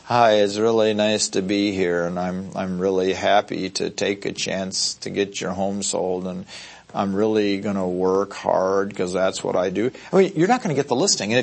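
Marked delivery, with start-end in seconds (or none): 0.0-9.9 lower, softer, slow and lifeless; mock a dull, low-energy pitch; flat unenthusiastic agent. Agent at a seller's door, dull and lifeless. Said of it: "If you walk in".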